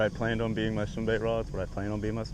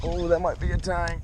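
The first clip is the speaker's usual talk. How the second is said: excited; 6 semitones higher than usual. Real, not acted. Excited at big fish.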